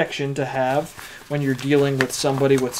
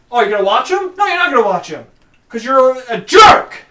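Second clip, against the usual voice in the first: sarcastic rant; 8 semitones higher, 8 dB louder than usual. Acted.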